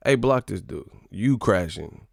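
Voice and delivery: usual speaking voice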